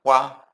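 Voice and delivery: "Wa" with the mouth wide open; open wa sound. Shown as a demonstration. Teacher isolating the open vowel of "Bonsoir" for students. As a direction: open up fully on "Wa". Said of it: "Abre bem".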